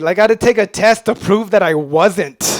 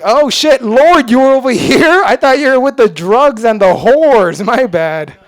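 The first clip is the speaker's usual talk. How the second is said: loud, high startled "oh shit" to the Lord; startled apology; 5 semitones higher, 7 dB louder than usual. Retold or imitated. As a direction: caught off guard, then sheepish. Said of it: "Every Mexican's like".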